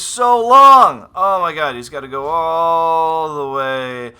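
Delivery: loud, drawn-out exclamation; exasperated outburst. Real, not acted. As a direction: stretch it out in loud dismay. Exasperated reaction to the map's length.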